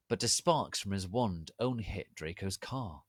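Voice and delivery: ordinary delivery, nothing marked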